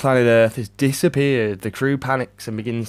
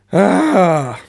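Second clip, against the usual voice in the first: loud, high groan of frustration; frustrated groan; 7 semitones higher, 7 dB louder than usual. Real, not acted. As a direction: let out a big exasperated "ah". Frustrated outburst at movie.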